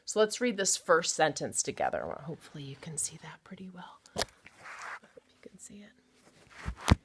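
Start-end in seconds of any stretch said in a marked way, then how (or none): 2.0-7.0 whisper. Real, not acted. Teacher whispers aside at the camera.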